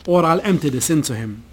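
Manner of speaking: ordinary delivery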